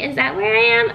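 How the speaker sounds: high, puzzled question; confused surprise. Real, not acted. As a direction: wonder aloud, lost. Unsure where she is.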